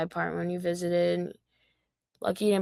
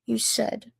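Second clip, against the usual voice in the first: ordinary talk, nothing marked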